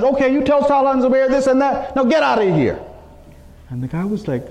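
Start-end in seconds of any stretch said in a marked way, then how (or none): 0.0-2.8 high-pitched acted harsh dismissal; harsh message. Imam sending the messenger back to Talha and Zubair, harshly. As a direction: send him packing sternly. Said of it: "gave him a very harsh message".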